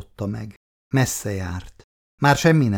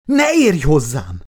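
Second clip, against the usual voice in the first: loud, slow, raised-pitch rebuff; yelling; 5 semitones higher, 6 dB louder than usual. A narrator's reading. Woman fending off the man touching her back, yelled. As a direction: yell it out, each word drawn out. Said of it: "ordította Anita".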